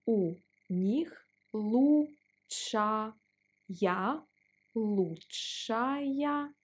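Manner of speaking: у них лучшая пицца в городе spoken slowly; deliberate teaching pace; slow drill. Shown as a demonstration. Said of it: "Let's pronounce it slower".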